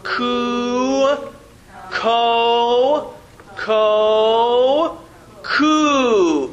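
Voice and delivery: each syllable called out slowly for the class to repeat; syllable drill. Shown as a demonstration. Teacher leads the class in a slow chanted syllable drill. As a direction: lead the class through the drill one sound at a time.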